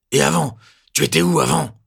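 loud, high spitting anger; spat out. A narrator's reading. Lieutenant spitting the question at Lupin, loud and high. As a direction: spit the words out. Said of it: "crache Sylvenair".

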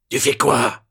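loud, high menacing demand; nasty questioning. A narrator's reading. Lieutenant confronting Lupin, loud and nasty. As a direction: interrogate him with menace. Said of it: "il m'interroge, mauvais".

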